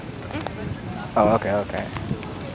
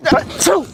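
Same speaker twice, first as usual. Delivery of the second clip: fake sneeze; 14 semitones higher than usual. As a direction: burst out a put-on sneeze. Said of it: "I'm sneezing on people".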